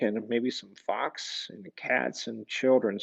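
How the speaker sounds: usual speaking voice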